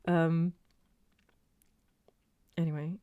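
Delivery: ordinary delivery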